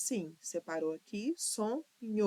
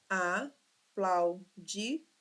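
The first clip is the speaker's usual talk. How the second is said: aplaudido sílaba por sílaba; much slower than usual. Shown as a demonstration. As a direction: split aplaudido into slow, separate syllables. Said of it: "quatro sílabas". (aplaudido silabado, bem devagar)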